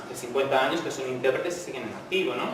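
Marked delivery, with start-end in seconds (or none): none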